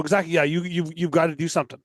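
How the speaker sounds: ordinary delivery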